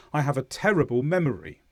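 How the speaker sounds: "terrible memory" said quickly in one flow. Shown as a demonstration. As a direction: run through "terrible memory" at everyday speed. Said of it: "normally say that faster like this".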